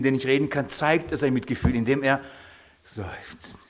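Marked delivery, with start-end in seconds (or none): 2.8-3.6 whispered out on a sighing breath; sighed word. Shown as a demonstration. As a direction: let the word escape as a soft, voiceless sigh. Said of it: "Das ist dieses Seufzen". (geseufzt, tonlos gehaucht)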